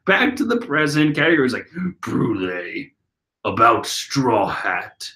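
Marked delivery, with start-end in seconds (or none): 2.0-2.9 deep Katakuri voice. Retold or imitated. Katakuri's deep voice used by mistake for Brulee's line. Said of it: "That's Brulee talking". 3.4-5.1 deep Katakuri voice. Retold or imitated. Reader voices Brulee's line in Katakuri's deep voice by mistake. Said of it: "That's Brulee talking".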